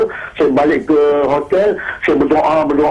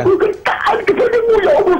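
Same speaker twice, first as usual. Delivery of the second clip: very high, breaking voice; pledging obedience while crying; tearful vow; faster than usual. Retold or imitated. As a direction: make the vow through sobs. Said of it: "Saya menangis-nangis".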